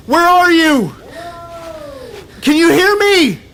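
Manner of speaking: loud, high calls; shouting. Acted. Man searching for Eve, shouting loud. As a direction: shout into the darkness.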